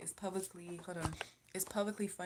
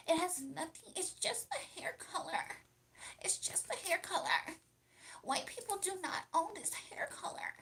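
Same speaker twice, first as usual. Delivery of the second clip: very high, far-from-natural mock-polite voice; high, prissy put-on voice; 9 semitones higher than usual. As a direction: pitch it way up and deliver it in measured phrases. Puts on a high mocking voice.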